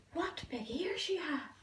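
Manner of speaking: frightened whisper. Acted. Girl nervously noticing the wolf's ears, whispered. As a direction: breathe it out in fear. Scared girl facing disguised wolf.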